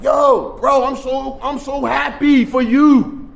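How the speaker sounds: imitating his little brother's delight; excited brother. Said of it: "He was all excited".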